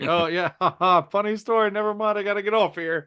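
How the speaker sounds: high, hurried fake-chuckle excuse; awkward brush-off. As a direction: laugh it off and try to hang up. Hurrying off the phone call.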